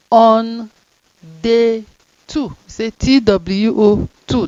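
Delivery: On and day punched loud; dictation, words punched; word-by-word reading. Shown as a demonstration. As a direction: read each word loud and slow. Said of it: "You must call am like that".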